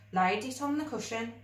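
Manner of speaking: ordinary delivery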